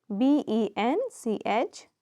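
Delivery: slow letter-by-letter spelling; measured classroom spelling. Shown as a demonstration. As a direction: give each letter its own beat for pupils writing it down. Teacher spells bench for pupils.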